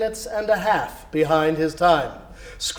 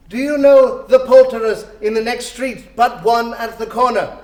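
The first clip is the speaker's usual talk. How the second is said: put-on higher, louder eager old man; eager question; 5 semitones higher, 7 dB louder than usual. Scrooge eagerly asking the boy about the poulterer. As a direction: ask brightly and urgently.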